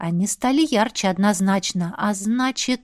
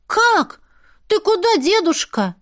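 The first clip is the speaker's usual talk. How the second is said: put-on high, startled kid voice; high surprised child; 8 semitones higher than usual. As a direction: ask like a surprised boy. Boys ask grandfather.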